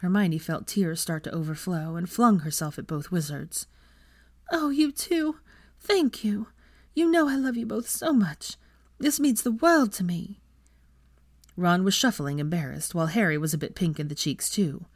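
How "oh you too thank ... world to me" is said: put-on young woman voice. Hermione voiced by narrator.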